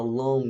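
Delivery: 'long' with a released hard G, 'long-guh'; sounded G. Shown as a demonstration. Teacher showing what to avoid, sounded G. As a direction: wrongly pop the G at the end of 'long'. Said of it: "Não fala o G".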